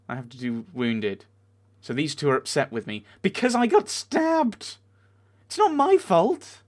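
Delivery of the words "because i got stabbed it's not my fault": high, loud and drawn-out indignation; outraged protest. Real, not acted. Player blamed for getting stabbed, outraged protest. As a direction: climb into a slow, incredulous yell. Outraged at characters being upset with them.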